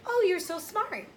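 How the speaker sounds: high, bright "Oh" in sincere admiration; gushing praise. Shown as a demonstration. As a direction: gush the compliment upward. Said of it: "someone could say to you".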